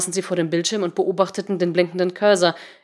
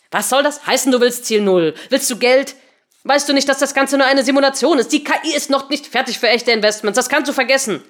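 loud, high, indignant questioning; heated, exasperated outburst; irate challenge; 6 semitones higher, 6 dB louder than usual. A narrator's reading. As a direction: scold the blackmailer at full voice. Henry berates the supposed extortionist.

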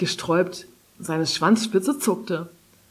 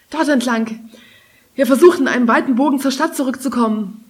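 raised, urgent directions; calling out; 6 semitones higher, 5 dB louder than usual. A narrator's reading. Maeva calls directions into Kari's ear in snow, urgent. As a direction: call over the wind, pitch up. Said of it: "rief Maeva ihr ins Ohr".

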